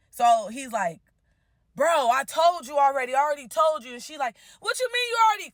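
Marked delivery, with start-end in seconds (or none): 1.7-3.9 raised, insistent mock protest; imitated exasperation. Fiance insists to the caller he already told her, exasperated. 4.6-5.5 high-pitched, indignant imitation; mock outraged retort. Bride imitates the rival challenging the fiance, outraged.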